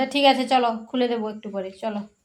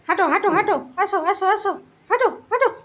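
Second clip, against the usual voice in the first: high loud urging to walk; high coaxing; 8 semitones higher, 5 dB louder, much faster than usual. Real, not acted. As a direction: egg the pet on, pitched up. (উঁচু গলায় উৎসাহ দেওয়া)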